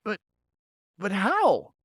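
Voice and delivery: lost for words, amazed; stunned beaver. Acted.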